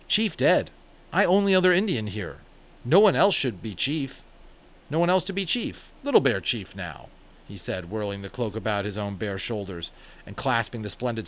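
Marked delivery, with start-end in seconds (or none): 0.1-7.1 a raised, proud warrior's claim; lightly voiced brave making himself chief; proud Little Bear. A narrator's reading. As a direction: declare yourself chief.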